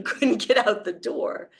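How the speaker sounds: normal talking voice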